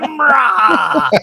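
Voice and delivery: high mock villain shout; Mumra. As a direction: cry it out like a cartoon villain. Imitating the revived villain's cry.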